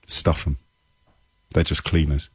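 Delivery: low, offhand contempt; callous dismissal. Acted. Host voices the Home Secretary dismissing cleaners, callous. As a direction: imitate an indifferent minister, muttered verdict. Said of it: "Home Secretary can look at".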